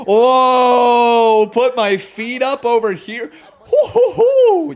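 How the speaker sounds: high, loud, laughing whoops; laughing exclamation. Real, not acted. Streamer leaning back in chair, laughing and whooping.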